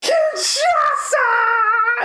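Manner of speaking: very high, long drawn-out scream; Sami Zayn. Retold or imitated. Imitating a wrestler screaming his friend's move, shrieked in excitement. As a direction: scream the move's name, stretching every syllable. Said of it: "and then he's just like".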